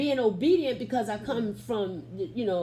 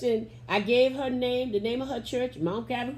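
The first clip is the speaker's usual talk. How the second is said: ordinary talk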